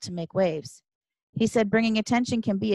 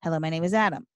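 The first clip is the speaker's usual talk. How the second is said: ordinary delivery, nothing marked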